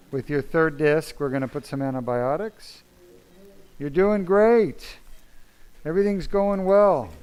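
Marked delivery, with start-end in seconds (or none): 3.8-7.1 slow, high, loud call to rouse him; calling out to patient. Real, not acted. Surgeon calls out to his waking patient, slow, high and loud. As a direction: reach a drowsy patient on the table.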